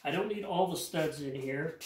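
ordinary talk, nothing marked

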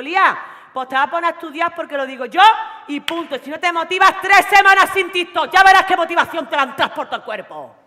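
loud, stern mother laying down the law; strict mother scolding. Retold or imitated. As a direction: threaten the daughter with no TikTok, shouting. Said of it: "¿Os da risa?". (madre estricta gritando a su hija Lía)

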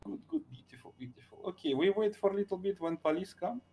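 put-on thick foreign accent, clipped broken grammar; broken English accent. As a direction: play a gruff foreign kidnapper with broken English. Broken-English criminal role throughout.

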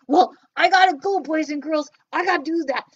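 a bouncy little puppet popping up. Pretend. Puppet Joe leaves.

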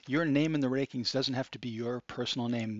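usual speaking voice

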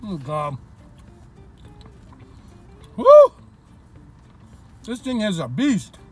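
usual speaking voice

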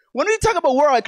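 normal talking voice

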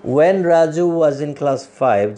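"when Raju was in class five" slow, as one sense group; slow phrased reading. Shown as a demonstration. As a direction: read it slowly as one unit. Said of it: "এখানে". (ধীরে একসাথে পড়া)

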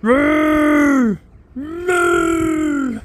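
loud, high mock moo; drawn-out playful cow call. Calling out to cows.

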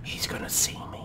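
tense hushed whisper. Real, not acted. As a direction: whisper so you're not spotted. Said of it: "We're still whispering".

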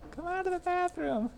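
high squeaky calling voice; high comic voice. Retold or imitated. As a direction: squeal it like a cartoon. Quoting a funny video.

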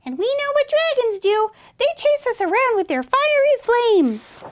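high, squeaky accusing voice; indignant high-pitched mouse; exclaiming mouse. A narrator's reading. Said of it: "Mouse exclaimed".

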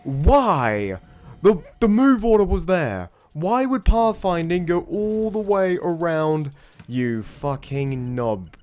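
slow furious yell at the screen; furious shout. Real, not acted. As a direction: rage at a dumb unit's pathfinding. Unit lost to pathfinding, raging.

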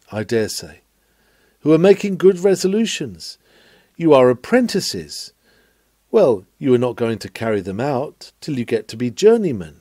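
ordinary talk

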